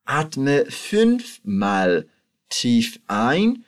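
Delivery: slow, calm drill voice, each word drawn out; slow guided relaxation. Shown as a demonstration. Teacher guiding student through breathing, slow and calm. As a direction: lead the breathing slowly.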